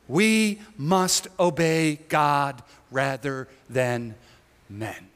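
slow, deliberate, fading on "men"; slow solemn. Shown as a demonstration. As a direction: lay each word down slowly. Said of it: "memorize anything from this passage".

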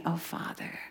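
breathy whispered plea; whispered prayer. Retold or imitated. Preacher whispers Jesus calling to his Father. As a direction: hush it to a whisper.

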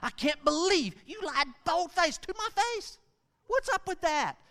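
high-pitched, indignant parental scolding; hammed-up incredulous parent, voice shooting up; outraged parent. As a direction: scold a lying child in disbelief. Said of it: "the ones that parents hate the most".

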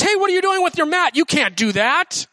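mock scold from a shocked neighbor; scolding religious leader. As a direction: call him out, then drag the scolding. Scolding voice again.

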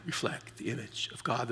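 soft repeat. Shown as a demonstration. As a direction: let it fall away to a hush. Repeats the key line softly.

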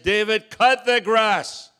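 loud, slow, angry father yelling the order; drawn-out furious command to a son; exasperated shout. Retold or imitated. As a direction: bellow it, fed up after three days. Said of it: "my hair standing on end, the back of my neck is red".

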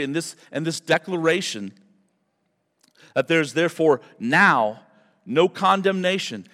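ordinary delivery

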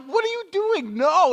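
high, alarmed protest; alarmed friend. Retold or imitated. Friend protesting on the ride, high and alarmed. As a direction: stop your friend, shocked. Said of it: "And you're like".

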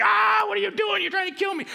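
put-on high panicked child cry; a scared kid yelling at his teacher; panicked yelp. Kid screaming at instructor.

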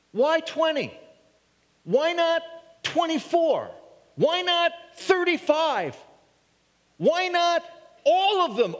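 high, pressing complaint; indignant objector. Acted. Critic objecting to the woman's gift, high and indignant.

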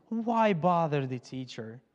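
slow, low, soft sneering friend; a hint of a scornful naysayer; scornful friend mocks the grieving father, softly; mocking voice. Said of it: "I'm doing that voice".

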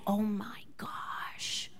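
hushed whisper; whispered tattle. Retold or imitated. Child whispering to mom what brother said, hushed. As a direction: whisper the naughty phrase like a secret. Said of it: "Theo said".

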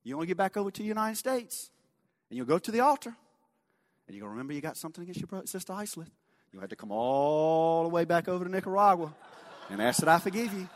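high, lilting imitation of a Nicaraguan accent; Isleth. Church sister teasing Brother Larry about forgiveness, imitated Nicaraguan accent. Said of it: "in that thick Nicaraguan accent".

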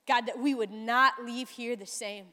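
soft, hushed prayer voice; quiet prayer. Acted. Pastor praying over the congregation before the sermon, softly. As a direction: pray gently and intimately to Jesus.

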